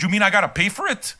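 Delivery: ordinary talk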